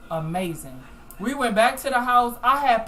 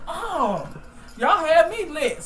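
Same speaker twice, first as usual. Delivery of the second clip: excited exclamation; 6 semitones higher, slower than usual. Retold or imitated. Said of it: "I'm like".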